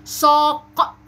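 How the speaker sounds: loud, held "ساق" with q released apart; exaggerated final qaf. Shown as a demonstration. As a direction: model the heavy q ending "ساق". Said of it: "أنا بوضح حرف القاف إزاي".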